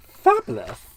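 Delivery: sarcastic. Real, not acted. Paper missing, sarcastic.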